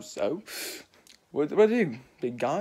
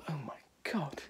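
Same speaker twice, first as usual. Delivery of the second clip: whisper; 12 dB quieter than usual. Acted. Companion stunned by revelation.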